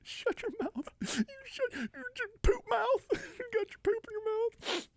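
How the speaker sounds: very high, slow, whiny character voice; squealing mock scold. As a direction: squeal the insult like a cartoon. High comic insult voice.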